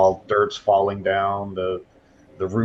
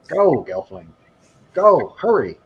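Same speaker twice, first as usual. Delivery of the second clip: swooping high, louder urging; urgent character voice; 5 semitones higher, 6 dB louder than usual. Acted. Augur looks back from her potion work, urgently shooing. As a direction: hurry them out in a high, insistent voice.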